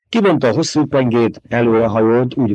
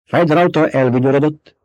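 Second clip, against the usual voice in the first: usual speaking voice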